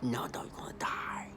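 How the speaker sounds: hushed, insistent death threat; mocking a sinister whisper; menacing whisper. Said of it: "they want you to be like".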